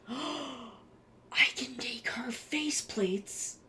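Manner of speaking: breathless whisper after a sharp gasp. Real, not acted. Streamer spotting faceplates she could make, whispering. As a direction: hush it like a delighted secret. Excited gasp over new figure.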